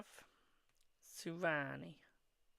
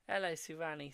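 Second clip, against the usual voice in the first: ordinary talk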